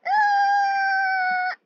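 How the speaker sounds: long high wailing scream; anguished scream. Real, not acted. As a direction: scream in despair. Screaming in game panic.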